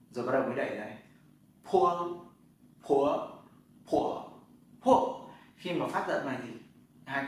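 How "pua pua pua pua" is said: "pua" with a strong puff of air, lips popping open, loud; aspirated pua drill. Shown as a demonstration. Teacher showing students how to aspirate "pua". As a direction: push the air out on "pua" through four tones. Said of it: "Chữ P thì các bạn phải bật hơi".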